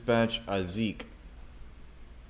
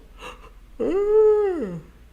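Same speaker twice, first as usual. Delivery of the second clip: loud high theatrical gasp; mock gasp; 8 dB louder than usual. Streamer gasps loudly in mock shock at the article. Dramatic gasp after reading.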